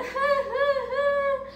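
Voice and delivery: a high, hammed-up sob; high mock sob. Butterfly cries even harder, a high hammed-up sob. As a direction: wail like a small child. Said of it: "começou a chorar mais ainda".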